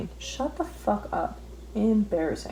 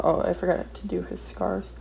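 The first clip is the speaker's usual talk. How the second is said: ordinary talk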